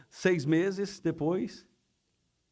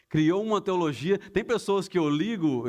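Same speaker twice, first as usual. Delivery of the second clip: ordinary delivery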